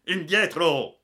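loud, high shouted order; captain's yell. A narrator's reading. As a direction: yell a sharp warning to get back. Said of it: "aveva urlato il capitano". (urlo imperioso del capitano)

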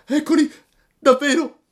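very high, sudden alarmed cry; startled puppet spotting the robbers; sharp high fright; frightened gasp. Acted. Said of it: "disse dentro di sé".

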